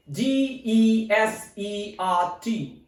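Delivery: slow, separate letter names; spelling out. Shown as a demonstration. Teacher spelling a word for learners, spelling out slowly. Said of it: "it is its spelling".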